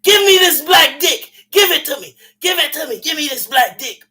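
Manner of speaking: high shrill-free mock shout of a woman mid-sex; mock woman crying out. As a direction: belt out her demands as a hammed-up impression. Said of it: "She would just say".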